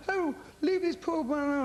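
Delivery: high, loud mock outraged lady; indignant woman. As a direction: squeal it like a shocked bystander at the airport. Woman objects at the airport.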